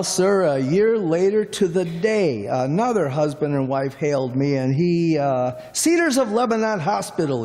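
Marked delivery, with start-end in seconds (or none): 5.7-7.4 high, hurried mock yell; retold urgent yell. Cabbie retelling another husband hailing him, mock urgent yell. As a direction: rattle it off as an imitated frantic passenger. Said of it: "he yells".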